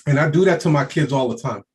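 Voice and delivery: normal talking voice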